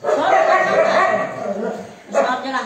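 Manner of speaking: high, loud outburst to a hurting pet; loud fussing exclamation. Real, not acted. Carer finding sore spots everywhere on the dog, loud fussing exclamation. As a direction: exclaim loudly over the animal's aches. Exclaiming over dog's hurts.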